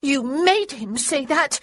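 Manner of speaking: high, loud, slow furious accusation; enraged girl. A narrator's reading. Little sister furious at her brother, loud accusing rage. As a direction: hurl the blame at her brother. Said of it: "face grew red with rage".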